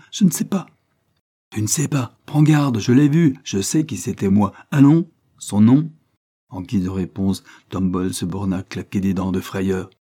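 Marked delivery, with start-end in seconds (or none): 0.1-0.7 much higher, scared denial; panicked denial. A narrator's reading. Boy denying to the detective, panicked. Boy denies under threat. 1.5-5.9 higher, louder threatening interrogation; angry threat. A narrator's reading. Detective demanding the name at gunpoint, angry.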